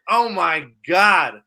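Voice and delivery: slow, loud thrilled gasp; excited. Real, not acted. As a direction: gush with delight. Excited about X-Men 97.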